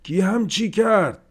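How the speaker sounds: yelled high and loud; a burst of outrage at the mess; sudden angry shout. A narrator's reading. Haji shouting at the household over the broken goat, furious yell. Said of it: "داد زد".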